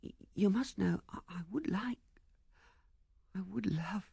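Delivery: high, soft, halting voice; hesitant, hushed admission; startled young woman. A narrator's reading. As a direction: stammer it softly and high. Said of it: "She looked at him, startled".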